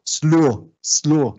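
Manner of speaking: SLOW as 'sluo', twice; emphatic SLOW with 'u' sliding into 'o'; Creole diphthong. Shown as a demonstration. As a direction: say SLOW as 'slu-oh'. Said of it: "va a ser en criollo uo".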